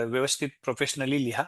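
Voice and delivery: normal talking voice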